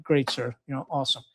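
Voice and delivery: low, soft, deadpan reply; unimpressed compliance. Retold or imitated. Tired Marine answering commander's Silver Star promise, unimpressed. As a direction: humor the boss with understated indifference.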